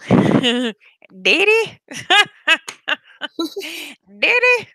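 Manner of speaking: high-pitched, drawn "Diddy"; imitating friends' "Daddy". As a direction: squeak out "Diddy" like the friends calling Dad. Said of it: "all of my friends called their dad that".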